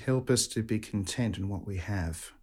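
calm, reverent prayer voice; closing prayer. Real, not acted. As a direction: pray it steadily and devoutly. Priest leads the closing prayer.

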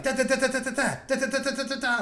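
rapid da da da typing noise; mouth typing sounds. Retold or imitated. Imitating quick typing sounds.

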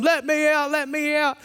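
put-on high, playful child's plea; imitating a child. Son begging from the cupboard, high childish plea. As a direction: squeak it out like a giggling kid.

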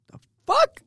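high-pitched stunned "the fuck"; shocked. Retold or imitated. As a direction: blurt it out in disbelief. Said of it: "And I said".

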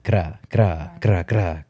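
"gra" repeated with a light tapped R; rolled gra. Shown as a demonstration. Showing how gracias is said, tapped R on "gra". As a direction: tap the R in "gra" each time. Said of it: "you do that a little bit".

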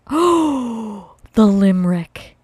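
sudden gasp of remembering; gasp, then remember it; surprised. Real, not acted.

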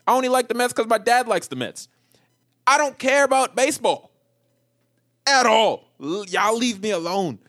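high, loud comic exasperation; exasperated rant. Acted. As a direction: plead loudly to be left alone. Worked-up rant about sports fans.

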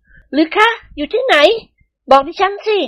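very high, drawn-out questioning; astonished wife. A narrator's reading. Wives learn husbands stayed in Bangkok, astonished demand. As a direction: ask eagerly at the top of the register.

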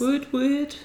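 high playful woot woot; mock cheer. Real, not acted. Cheering her job move.

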